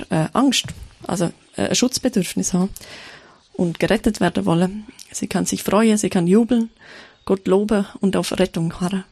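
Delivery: usual speaking voice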